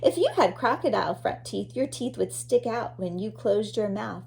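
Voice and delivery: ordinary talk, nothing marked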